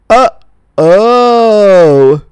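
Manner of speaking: loud, drawn-out comic uh-oh; mock "uh-oh". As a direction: sing out a dawning-horror uh-oh. Acts out the awkward discovery.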